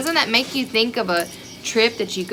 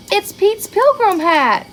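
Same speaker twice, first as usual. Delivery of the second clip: surprised reveal; 10 semitones higher, 5 dB louder than usual. Acted. Gasp revealing the hat.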